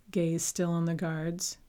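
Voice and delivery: usual speaking voice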